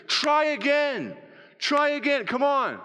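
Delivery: high, drawn-out rallying call; emphatic, pleading push to keep going; urging encouragement. Acted. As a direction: cheer someone on insistently. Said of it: "As we encourage one another".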